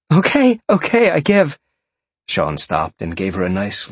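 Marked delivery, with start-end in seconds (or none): 0.1-1.6 high, loud, playful pleading; squealed surrender. Acted. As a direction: give in frantically while being tickled. Tickled character surrenders, squealing.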